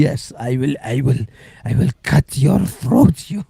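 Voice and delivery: slow, hammed-up Middle Eastern accent. Imagined troll threatening the host in a Middle Eastern accent. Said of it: "If it was Middle Eastern".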